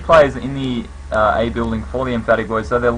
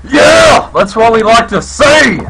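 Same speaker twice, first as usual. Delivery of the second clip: much higher, loud cheering shout; excited shout; 10 semitones higher, 7 dB louder, slower than usual. Real, not acted. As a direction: yell it out in delight. Cheering the round win.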